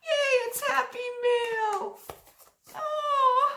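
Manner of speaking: high, loud, overcome squeal; real happy squeal on the edge of crying; tearful joy. Real, not acted. Vlogger opening a surprise gift, overjoyed and tearful. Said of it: "I'm not crying".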